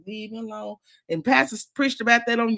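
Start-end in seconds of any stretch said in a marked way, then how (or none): 0.1-0.8 quiet plea. Retold or imitated. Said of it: "I be like".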